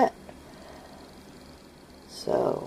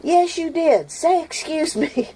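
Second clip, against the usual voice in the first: put-on girls yelling; 13 semitones higher, 3 dB louder than usual. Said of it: "the girls are like".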